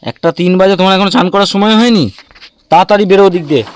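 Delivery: loud, high-pitched parental telling-off; stern scolding. Real, not acted. Parent scolds child to bathe. (চড়া গলায় বকুনি)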